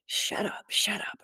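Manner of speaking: harsh whispered shut up, then voiced on kill; imitated whispered threat. Intruder threatening the woman in bed, harsh whisper. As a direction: hiss the threat under the breath, menacing. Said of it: "And it was really like".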